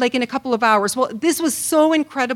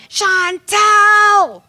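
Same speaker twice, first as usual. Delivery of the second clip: loud, high yell across the yard; yelling a name; 9 semitones higher, 8 dB louder than usual. Retold or imitated. Neighbor husband yelling for his wife, loud and high. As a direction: bellow her name from the backyard. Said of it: "he starts screaming at her".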